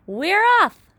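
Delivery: high, very loud joyful shout. A narrator's reading. A creature wakes everyone with a loud excited cheer. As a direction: yell it out in triumph. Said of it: "someone was shouting".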